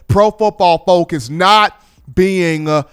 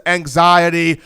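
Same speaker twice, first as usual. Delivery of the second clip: ordinary talk, nothing marked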